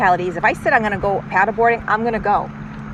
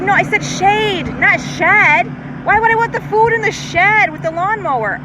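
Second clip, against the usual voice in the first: exasperated; 11 semitones higher, 4 dB louder than usual. Retold or imitated. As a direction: correct him, fed up with the mix-up. Retelling mix-up with delivery guy.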